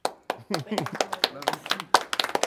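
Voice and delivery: a choked-up laugh after the speech; emotional laugh. Real, not acted. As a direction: laugh it off through rising tears. Moved to tears after speech.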